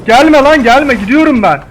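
very high, loud frightened yell; panicked shout. Real, not acted. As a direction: scream it while running away. Fleeing the robot in panic. (panikle kaçarken çığlık)

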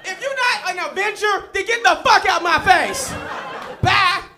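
roast him and send him away; a touch of a scornful LA woman; dismissive girl. Retold or imitated.

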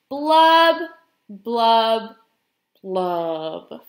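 strong first blub, then sinking; glum fish blubs. Acted. Pout-pout fish ending his dreary refrain, descending glum blubs. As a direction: drop each blub well below the last.

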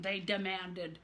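plain reading voice

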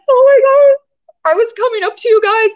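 high, loud retold gasp of surprise; imitating a teen's emotional amazement; surprised outburst.

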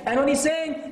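usual speaking voice

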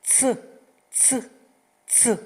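loud clear "ц". Shown as a demonstration. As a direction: punch out each "ц" loudly. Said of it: "А тепер голосніше".

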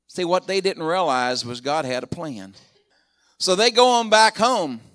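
normal talking voice

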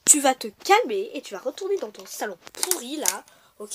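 usual speaking voice

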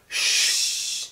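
shushing. A narrator's reading. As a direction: hush the gang so they stay hidden. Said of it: "said Cowboy Baby to his gang".

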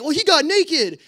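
high, loud, defensive outburst; retold indignant protest. Night watchman defends himself to youth director, imitated indignant protest.

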